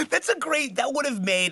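high-pitched words through laughter; real amused laughter in the voice; laughing. Real, not acted. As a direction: still cracking up, pitch way up. Laughing at the repeated line.

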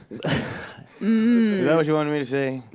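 ordinary delivery

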